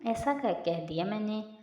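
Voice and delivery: usual speaking voice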